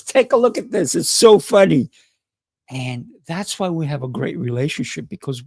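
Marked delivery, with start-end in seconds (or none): 0.0-1.9 high, bright-eyed excitement, a bit louder; excited fiancee. Retold or imitated. Fiancee showing him something funny, imitated with high excitement. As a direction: imitate her eager invite, pitched up. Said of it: "she goes".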